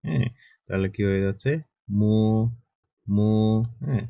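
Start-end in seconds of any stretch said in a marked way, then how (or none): 2.0-3.3 slow classroom demo of মো; Chinese mo demo. Shown as a demonstration. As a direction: hum into মো and hold the o. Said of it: "এম এর উচ্চারণ হচ্ছে ম এর মতো".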